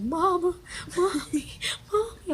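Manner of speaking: high, loud mock wailing; retold crying. Rider retelling crying for her mom on the ride, mock wailing. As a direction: wail for a parent like a scared child. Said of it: "I was crying. I was like".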